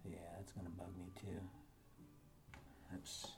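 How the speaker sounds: whispering while holding breath; breath held, barely voiced. Real, not acted. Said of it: "when I'm holding my breath".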